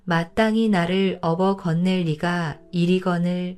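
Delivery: ordinary delivery, nothing marked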